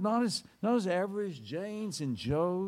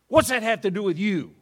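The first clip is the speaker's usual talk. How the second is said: loud, higher angry retort; gruff soldier; 4 semitones higher, 12 dB louder than usual. Acted. Soldier brushing off the rabbi's question, loud gruff retort. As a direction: snap back at him, irritated. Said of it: "the soldier asked".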